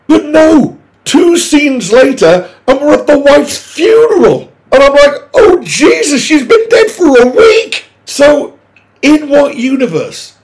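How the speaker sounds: exasperated high-pitched outcry; incredulous outrage. Real, not acted. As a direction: rant in appalled disbelief. Outraged rant about funeral scene.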